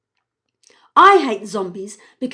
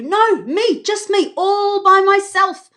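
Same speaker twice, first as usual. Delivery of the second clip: higher, louder, stubborn insistence; insistent; 10 semitones higher, 7 dB louder than usual. Acted. Evie arguing with Jack, stubbornly insisting. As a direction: dig your heels in.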